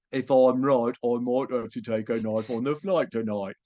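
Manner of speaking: mock British-style "oi" vowels; sister's exaggerated British-like imitation. Sister mimicking the actor's speech, mock British-ish accent. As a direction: overdo a British-ish accent, mocking someone's careful speech. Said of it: "And she said".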